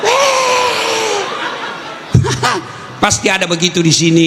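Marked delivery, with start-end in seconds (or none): 0.3-3.0 big laugh. Real, not acted. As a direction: burst out laughing at the joke. Lacht über eigenen Witz.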